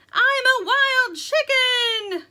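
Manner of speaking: very high, slow, loud battle cry; yelling. A narrator's reading. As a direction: wail it out before a wild leap. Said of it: "yelled Poppy".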